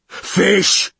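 high, loud shout of the name; furious yell. A narrator's reading. Cobbin yelling at fleeing Fish, furious high yell. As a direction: yell after a deserter. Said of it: "yelled Cobbin".

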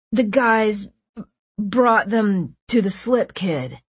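thick voice through a broken nose; halting, slow, nose-blocked speech. A narrator's reading. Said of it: "his voice altered by the sickening angle of his nose".

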